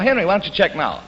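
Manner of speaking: normal talking voice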